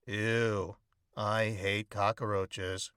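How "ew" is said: ordinary delivery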